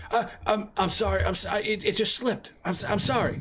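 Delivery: high, panicky, stammering plea; terrified pleading. Acted. Shopkeeper pleading with the gangster, high and terrified. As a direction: beg him to take the money and go.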